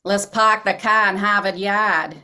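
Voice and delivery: quick Boston accent, dropped R's; Boston accent, pahk the cah. Shown as a demonstration. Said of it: "do a quick Boston accent for us".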